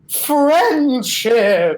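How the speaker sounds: loud, high hearty cheer. Acted. Bard clapping the boatman's back, hearty cheer. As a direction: sell it with a back slap. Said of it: "I clap him on the back and say".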